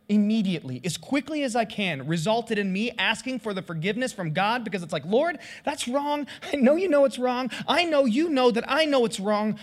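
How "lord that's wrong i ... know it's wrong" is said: high, insistent admission to God; worked-up confessional prayer; urgent confession. Acted. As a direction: plead guilty emphatically, pitch raised.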